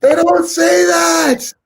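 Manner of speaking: very high, loud, drawn-out objection; shrieked protest. Real, not acted. Objecting to the co-host's Aussie phrase, high shrieked protest.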